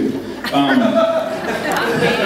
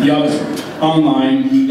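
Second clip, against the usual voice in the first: normal talking voice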